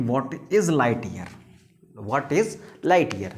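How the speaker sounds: drawn-out teacher question; slow it right down for the class; spelled-out heading; slow emphatic question. Shown as a demonstration. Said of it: "teacher stresses question slowly".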